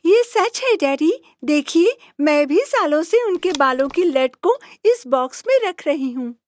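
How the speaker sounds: put-on high girlish princess voice. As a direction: tell daddy excitedly. Princess tells father.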